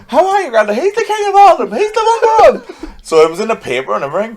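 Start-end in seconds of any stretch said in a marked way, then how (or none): 0.1-2.9 hammed-up fan praise. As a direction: gush over him like a starstruck fan. Mocking fans praising the coach.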